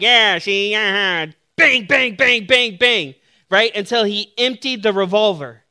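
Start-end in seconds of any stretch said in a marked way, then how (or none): 0.0-1.3 gangster voice. Retold or imitated. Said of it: "this gangster got his revolver and was like". 1.6-3.1 higher, louder mouth gunshots; gunshot sounds. Retold or imitated. Preacher imitating the gangster emptying his revolver, punchy bangs.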